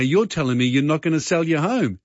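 ordinary delivery

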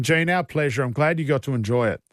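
ordinary delivery

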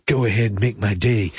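low, lightly imitated tough-guy line; movie catchphrase. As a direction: drop the pitch for a mock film threat. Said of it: "So Daryl says, uh, "So Clint".

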